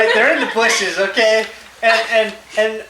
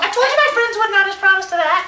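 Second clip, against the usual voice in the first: imitating her complaint; 9 semitones higher than usual. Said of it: "And she's going".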